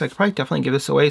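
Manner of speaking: ordinary talk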